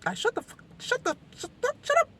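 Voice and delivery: snapping at herself, getting shriller till she bursts out "shut up"; annoyed at herself. Retold or imitated. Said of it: "I was annoying myself".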